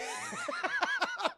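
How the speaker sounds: squealing laugh; real giggle; high laugh. Real, not acted. Laughs at mom's driving.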